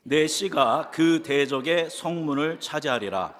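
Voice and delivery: slow, measured Bible reading aloud; scripture reading. Acted. Pastor reading Genesis to the congregation, slow solemn reading. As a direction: read each phrase slowly like holy text.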